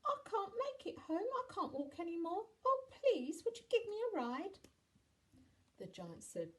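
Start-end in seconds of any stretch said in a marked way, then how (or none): 0.0-4.6 put-on very high, pleading child voice; mock cry of a worn-out small boy; tearful little boy. A narrator's reading. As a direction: beg plaintively in a high child voice. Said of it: "he slumped to the ground and he cried".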